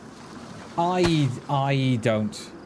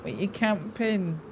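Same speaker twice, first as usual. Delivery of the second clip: mocking imitation; 6 semitones higher, much slower than usual. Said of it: "And they're all like".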